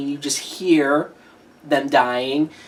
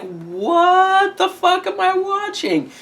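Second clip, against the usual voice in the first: high, drawn-out, incredulous wail; mock bewilderment; slower than usual. Baffled viewer reacting to the happy ending, incredulous mock wail. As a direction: stretch out the first word in exaggerated disbelief. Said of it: "and you're like".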